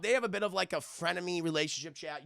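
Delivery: ordinary talk, nothing marked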